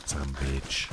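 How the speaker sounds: low, soft put-on answering voice; mock answer. Streamer answering for the viewer, low soft put-on voice.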